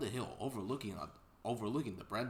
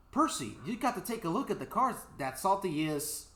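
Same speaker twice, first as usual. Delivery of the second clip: eager call; 7 semitones higher, 6 dB louder than usual. Acted. Thomas calling to Percy.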